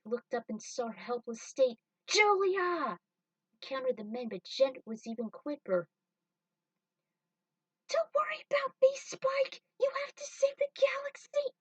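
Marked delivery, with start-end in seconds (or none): 2.1-3.0 higher, loud call of her name; alarmed shout. Acted. Spike sees Julia helpless. 7.9-11.5 very high, fast breathy urging; desperate plea. Acted. Julia urging Spike while trapped.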